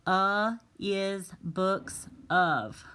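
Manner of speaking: slow, word by word; a teacher slowly reading a jumbled sentence; reading the scrambled words. Shown as a demonstration. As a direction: read the jumbled words out one at a time. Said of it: "The first one says".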